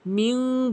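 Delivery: মিন with a long nasal hum on ন; ghunnah. Shown as a demonstration. Teacher shows the ghunnah, humming মিন. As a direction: hold the ন of মিন through the nose. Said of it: "গুণনা করতে হবে".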